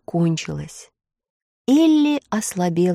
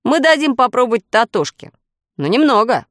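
louder, higher, decided tone; resolute objection; 5 semitones higher, 5 dB louder than usual. A narrator's reading. Brother deciding the dog tastes first, firm reply. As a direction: lay down the decision firmly. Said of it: "решительно возразил Фред".

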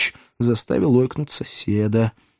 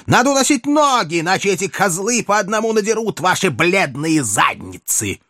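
rough rider; 8 semitones higher than usual. Acted. Same rider keeps shouting orders.

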